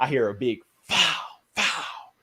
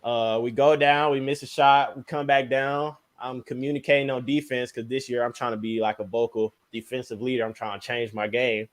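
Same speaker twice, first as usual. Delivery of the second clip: ordinary talk, nothing marked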